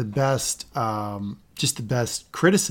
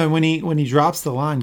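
normal talking voice

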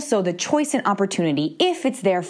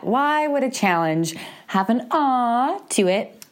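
normal talking voice

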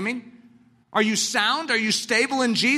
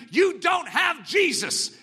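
shout; 9 semitones higher, slower than usual. Acted. Preacher's climax warning.

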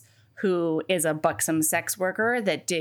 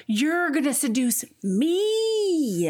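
mock-haughty demand; 4 semitones higher than usual. Heroine telling him he must seduce her, mock-haughty demand. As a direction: put on her demanding voice, stretching it out.

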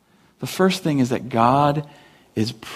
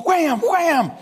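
comic whack sound. Retold or imitated. Imitating whacking the rock.

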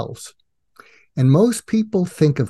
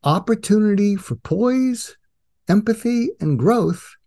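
higher-pitched, measured recitation; coaching mantra; 7 semitones higher than usual. Acted. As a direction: recite it like a reminder in someone's head. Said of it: "hear my voice saying to you".

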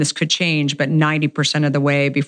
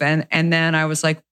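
ordinary talk

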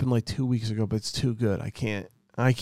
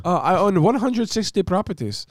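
high put-on accent, quoting the landlord; mock Indian accent; 6 semitones higher than usual. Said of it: "and he's like".

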